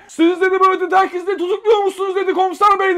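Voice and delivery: high, loud outraged bellow; furious father. Retold or imitated. Father storming into the station to defend his son, furious shout. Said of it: "delire delire ... hışımla".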